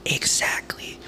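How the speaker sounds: soft, voiceless whisper; hushed whispered aside; whispered agreement. Real, not acted. Quiet agreement under the talk.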